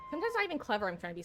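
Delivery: fast, self-mocking rush; quick embarrassed aside. Real, not acted. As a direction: rattle it off quickly. Rushed self-deprecating mutter.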